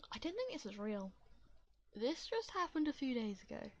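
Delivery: ordinary talk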